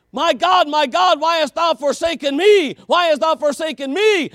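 high, loud cry with the weight on "me"; "me" pushed up and out at the end of the cry; stress on me. Acted. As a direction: lean hard on "me" in "forsaken me". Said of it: "Emphasis on the me".

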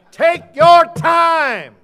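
slow, booming preacher's command; preaching shout. Acted. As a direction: drag it out, thundering. Preaching advice shouted slowly.

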